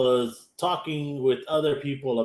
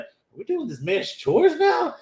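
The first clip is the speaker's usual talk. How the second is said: high-pitched baffled complaint; mock incredulous; 12 semitones higher than usual. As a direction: imitate someone squealing at unexpected chores. Retells worker's repeated complaint.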